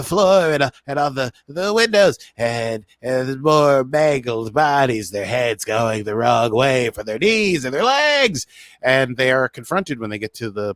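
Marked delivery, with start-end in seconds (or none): 2.4-8.5 slow, louder gory emphasis; dramatic dread. Acted. Host dwelling on mangled bodies, slow and louder. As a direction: linger on the gore for effect.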